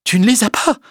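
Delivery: high, insistent rising question; distraught, high-pitched disbelief; incredulous repetition. A narrator's reading. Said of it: "Elle répéta plusieurs fois".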